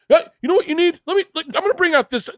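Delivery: very high, quick, excited salesman; retold eager rush, pitch shooting up; giddy excitement. Club fitter offering the golfer better gear, giddy.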